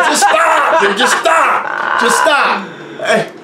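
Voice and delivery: high, strained begging cries; retold desperate plea. Begging a car to stop, retold.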